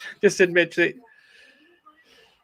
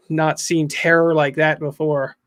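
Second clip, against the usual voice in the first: ordinary delivery, nothing marked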